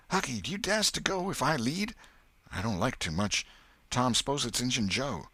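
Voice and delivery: put-on high eager boy whisper-dare, then hesitant; coax, then back off scared; daring boy. Boys dare each other.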